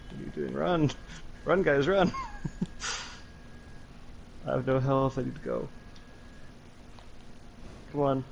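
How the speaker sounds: frantic high yelps, laughing in panic; panicking gamer. Real, not acted. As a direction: panic as death closes in. Fleeing enemies with no health.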